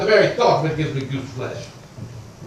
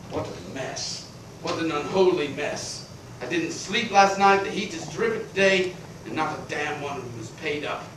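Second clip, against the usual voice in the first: soft, low, spent grumble; weary muttering; 6 semitones lower, 11 dB quieter than usual. Acted. Exhausted creditor grumbling to himself, quiet and worn. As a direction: mutter to yourself, drained.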